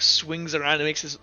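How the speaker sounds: usual speaking voice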